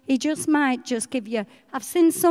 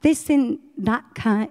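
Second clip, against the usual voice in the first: usual speaking voice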